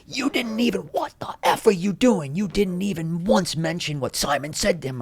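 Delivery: high-pitched, strained angry tirade; acted scolding rant, voice jumping way up; chewing someone out. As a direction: imitate a producer furiously tearing into someone. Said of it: "he just starts chewing me out".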